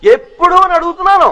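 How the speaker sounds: loud, high, drawn-out demand; demanding shout. Acted. Devotee demanding an answer from the Swami, exasperated shout. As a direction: shout it slowly up at the god.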